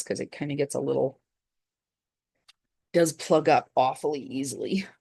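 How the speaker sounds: ordinary delivery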